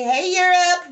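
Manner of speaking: high, loud greeting call. Real, not acted. Reader hails her viewers in Europe with a high, loud call. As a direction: call it out to viewers far away.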